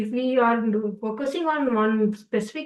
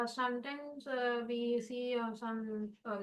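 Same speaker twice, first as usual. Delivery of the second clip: much softer, faint voice; barely audible; 15 dB quieter than usual. Real, not acted. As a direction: speak so low it barely carries. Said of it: "Sorry, your voice is very low".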